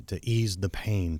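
usual speaking voice

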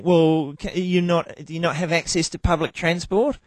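mock clerk. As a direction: question him as if he were daft. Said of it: "And she's going".

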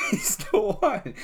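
slow, laughing mock echo. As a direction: tease with a drawn-out laugh. Mocking repeat with laugh.

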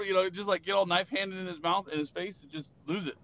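usual speaking voice

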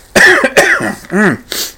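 sharp, loud real cough; coughing fit. Real, not acted. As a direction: cough hard into the mic. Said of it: "sorry about coughing".

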